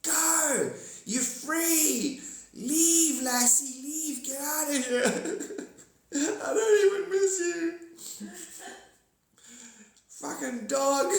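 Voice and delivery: very high, loud shouting, laughing and crying into it; screaming them away. Pretend. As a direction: chase them off at the top of your lungs. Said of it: "humans screaming at the aliens".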